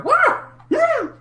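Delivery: a squealing wah, very high and strained; weird noise. Retold or imitated. Mocking King's weird commentary noises, high squeal. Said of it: "weird noises and go".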